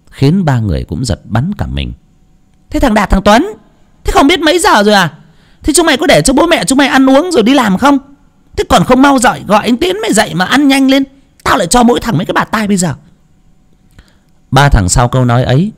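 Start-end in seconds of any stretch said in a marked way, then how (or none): 2.7-12.9 sharp-tongued aunt. A narrator's reading. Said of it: "cái giọng chua ngoa lắm".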